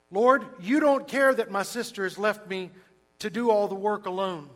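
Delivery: indignant outburst. Retold or imitated. Martha complaining to Jesus about her sister: indignant outburst. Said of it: "Screaming it at the top of their lungs".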